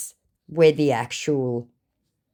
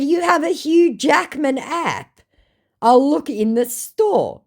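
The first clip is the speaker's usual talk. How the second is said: higher, slow sarcastic reply; mock sarcasm; 11 semitones higher than usual. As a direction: play along slowly with obvious sarcasm. Mocking reply to the scammer.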